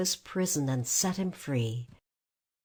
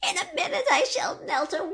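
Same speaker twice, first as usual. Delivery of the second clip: wailing witch; faster than usual. A narrator's reading. Witch melting.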